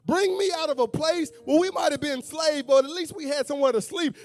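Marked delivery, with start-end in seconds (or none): none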